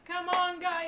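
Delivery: high, loud urging; rally the crowd to join in; rallying cheer. Real, not acted. Streamer urging viewers to vote, high and loud.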